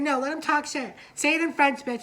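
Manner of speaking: high, loud sassy retort; mock sassy shout. House member daring the French rival, high and loud. Heated shade exchange.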